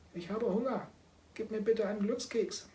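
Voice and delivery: high, pleading little cat voice; kitten voice. Acted. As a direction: beg like a hungry kitten. Kitten character repeats request. (hohe bittende Kätzchenstimme)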